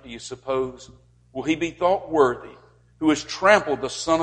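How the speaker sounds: rising, forceful sermon reading; fervent scripture reading. Acted. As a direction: proclaim the warning like a preacher. Preacher reads Hebrews aloud.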